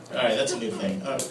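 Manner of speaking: usual speaking voice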